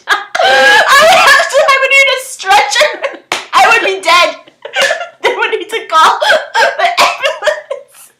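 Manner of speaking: loud, high, words breaking into laughter; hysterical laughing. Real, not acted. Fan saying she'd need a stretcher, laughing hysterically. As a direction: shriek it through helpless giggles. Laughing hard, imagining herself there.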